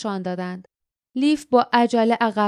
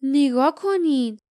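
slow, higher, a touch hoarse; stunned, the words catch in the throat; a slow, husky look at this; 4 semitones higher, slower than usual. A narrator's reading. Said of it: "با صدایی گرفته گفت".

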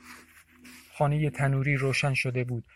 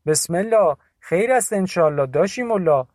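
put-on raised, higher old woman's blessing; Bibi's voice; 5 semitones higher, 7 dB louder than usual. A narrator's reading. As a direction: call out a worried blessing to the visitor. (صدای پیرزن، بلند و نگران)

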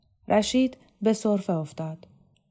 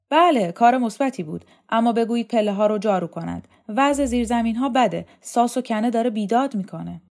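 louder, higher critical inspector; 4 semitones higher, 7 dB louder than usual. Acted. Visiting inspector lists faults to the dormitory chief, critical. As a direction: find fault firmly and officiously. Voices Vahab's inspection complaints.

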